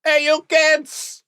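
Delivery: put-on slow, high Brooklyn shout at kids; drawn-out Brooklyn tough guy calling out; Brooklyn accent. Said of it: "I'm like a Brooklyn guy".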